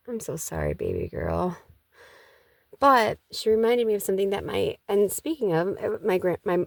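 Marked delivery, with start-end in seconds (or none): 0.1-1.6 tender apology. Real, not acted. Apologizing to her youngest daughter.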